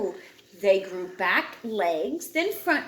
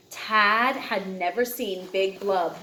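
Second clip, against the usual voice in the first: ordinary delivery, nothing marked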